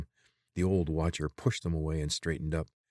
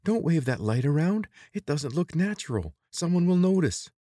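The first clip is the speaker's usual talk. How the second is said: put-on much higher, louder girl voice; urgent Teek; 11 semitones higher, 6 dB louder than usual. A narrator's reading. Teek urges Corvin to hide the light, high and urgent. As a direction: scold him quickly to stop.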